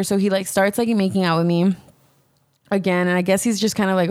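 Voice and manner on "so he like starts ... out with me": usual speaking voice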